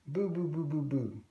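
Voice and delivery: put-on light baby babble. A narrator's reading. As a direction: coo it like a toddler. Baby playing clown.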